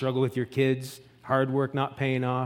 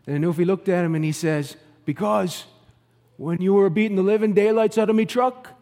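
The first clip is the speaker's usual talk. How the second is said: higher, louder Newfie storyteller voice; Newfie accent; 6 semitones higher, 5 dB louder than usual. Acted. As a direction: spin a yarn in a lively, hammed-up Newfoundland accent. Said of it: "a little bit of a Newfie accent".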